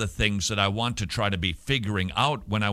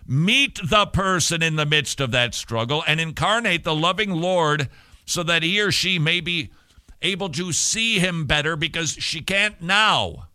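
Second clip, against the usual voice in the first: higher and louder, rising fervor; preaching climax; 5 semitones higher, 3 dB louder than usual. Real, not acted. Preacher urging parents, rising to a passionate climax. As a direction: build to a passionate peak.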